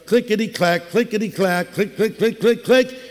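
rhythmic clicking like a coaster chain; chanted coaster clack; roller coaster clicks. Retold or imitated. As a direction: tick out the climb, click by click. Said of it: "going up the track".

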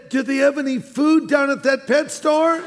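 hint of a talking parrot. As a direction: deliver the parrot's punchline up high. Dying parrot's last words.

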